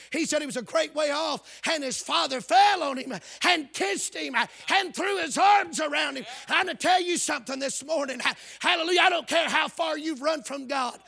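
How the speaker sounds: loud, high preaching climax; full-force preaching. Acted. Preacher calling runaways back to God, shouting. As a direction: shout the welcome home to the sinners.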